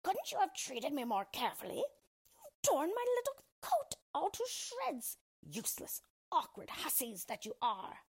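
high, scolding screech of a dwarf; screechy little dwarf's tirade. A narrator's reading. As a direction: scream it indignantly in a screechy, high voice. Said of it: "he screamed in his screeching voice".

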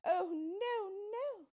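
high, loud wailing refusal; anguished cry. A narrator's reading. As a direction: wail in horror, voice shooting up. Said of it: "she cried".

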